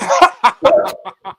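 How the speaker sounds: high-pitched burst of laughter; a sudden high laugh. Real, not acted. Podcaster cracks up at the Champions League jibe, laughing.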